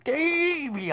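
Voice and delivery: high, drawn-out yell of a name; retold scream. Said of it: "I scream".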